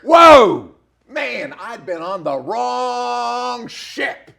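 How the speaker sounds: slow, high preacher's exclamation; rising impassioned oratory. Real, not acted. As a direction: proclaim it like a sermon. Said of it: "Do I sound like a preacher there?".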